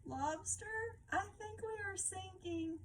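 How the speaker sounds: high, worried crab voice; a hammed-up scared little crab; frightened crab. A narrator's reading. Crab crying out to the lobster that they sink, mildly frightened. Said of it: "cried the crab".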